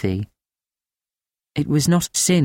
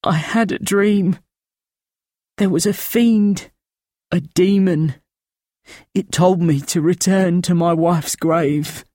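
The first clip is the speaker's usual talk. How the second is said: higher, thin rasping voice of a haunted man; thin rasping old voice; 6 semitones higher than usual. A narrator's reading. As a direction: rasp it out thin and frightened, pitched up. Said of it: "His voice a thin, rasping trickle".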